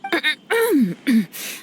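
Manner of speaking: self-conscious little throat clear; flustered ahem; awkward throat clearing. Acted. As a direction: clear your throat to cover embarrassment. Embarrassed after being praised.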